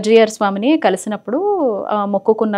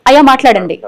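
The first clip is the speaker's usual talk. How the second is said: loud, raised prompt; calling out to caller; 4 semitones higher, 8 dB louder than usual. Real, not acted. Host urges silent caller to speak, calling out loudly. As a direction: pitch up and project to the caller.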